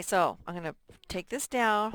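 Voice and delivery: usual speaking voice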